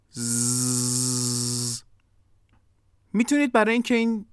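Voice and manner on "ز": long voiced "ز", a steady low buzz; sustained z sound. Shown as a demonstration. Teacher has learners produce the sound along, long buzzed z. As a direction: hold "ز" as a soft buzzing zzz. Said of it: "با من این صداها رو تولید کنید".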